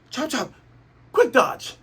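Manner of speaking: high, loud villain callout; attack callout. Retold or imitated. Buggy calling his attack as Luffy charges, high shout. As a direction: shout the move name like an anime villain.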